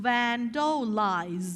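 "vandalize" drawn out slowly, VAN-do-LIZE; slow model pronunciation. Shown as a demonstration. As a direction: stretch each syllable of "vandalize" for learners. Teacher models the new word. (ออกเสียง "vandalize" ช้าๆ ทีละพยางค์)

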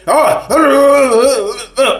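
high-pitched mocking outburst; mock fussing. Mimicking people who argue over nothing, mock fussing. Mimics someone starting a fuss.

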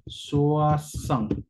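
"soixante" con el diptongo wa marcado; diphthong demo. Shown as a demonstration. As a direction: pronuncia soixante como swasant. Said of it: "tenemos un diptongo". (soixante con diptongo wa)

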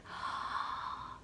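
reenacted gasp of wonder; soft, drawn-in gasp of amazement; amazed gasp. Student nurse reliving being remembered, amazed gasp.

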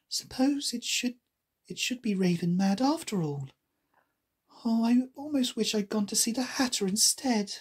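understated nervous musing; mildly timid. A narrator's reading. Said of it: "walked up towards it rather timidly".

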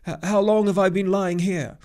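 loud, high startled question with a stutter; startled waking. A narrator's reading. Centaur waking in a strange tent, startled. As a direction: blurt it out, thrown and confused. Chiron wakes confused in a tent.

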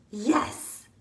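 ordinary delivery

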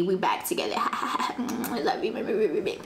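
whispered joke aside. Pretend. Confessing she got back with her ex, in a whisper. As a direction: whisper it like a guilty secret. Joking about toxic reconciliation.

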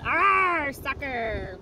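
loud pirate arr; pirate yell. Acted. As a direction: growl a pirate cheer.

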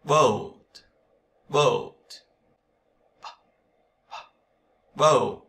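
"Boat" with voiced B and a gliding ơ u vowel; model pronunciation. Shown as a demonstration. English teacher modelling "Boat" for students to repeat. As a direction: say "Boat" slowly, voicing the B. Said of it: "Bờ và ơ u nào".

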